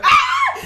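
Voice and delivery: high excited squeal. Real, not acted. Getting hyped before the rhyme, high squeal. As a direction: let out a sudden high squeal.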